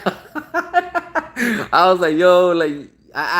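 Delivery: high, drawn-out laugh through the words; cracking up mid-sentence; breaking into laughter while telling it. Real, not acted. Laughing at the character.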